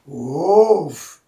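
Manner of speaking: loud gruff huff; interrupting tiger woof. A narrator's reading. Tiger cutting off the jackal, exasperated huff. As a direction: burst in with one loud huff. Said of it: "interrompit le tigre".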